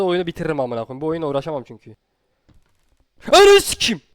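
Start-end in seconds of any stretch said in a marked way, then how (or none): 3.3-4.0 much higher, loud shriek; terrified scream. Real, not acted. Gamer hit by a jumpscare, shrieking. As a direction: shriek it out in fright.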